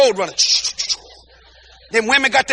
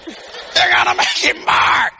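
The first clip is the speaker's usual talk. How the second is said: mock warning shout; 6 semitones higher, slower than usual. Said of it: "Paris said".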